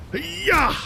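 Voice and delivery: triumphant yell. Real, not acted. Cheering in battle.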